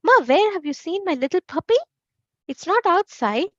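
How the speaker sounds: a hint of a child calling for mum; worried child. A narrator's reading. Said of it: "the first thing he asked was".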